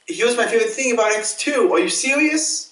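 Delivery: high, fast, outraged retelling; indignant disbelief. Retold or imitated. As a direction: replay your old outrage at the cut. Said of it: "I was like".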